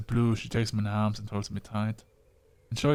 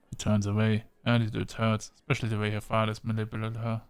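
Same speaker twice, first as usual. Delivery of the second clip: normal talking voice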